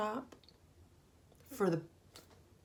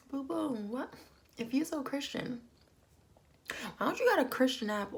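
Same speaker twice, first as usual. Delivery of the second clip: higher, disbelieving sass; incredulous mockery; 7 semitones higher than usual. Real, not acted. Reacting to Kenya on camera, sassy disbelief. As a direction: poke fun in disbelief. Mocking Kenya's Bible claim.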